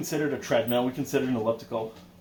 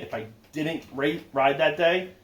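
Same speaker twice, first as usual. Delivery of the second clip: ordinary talk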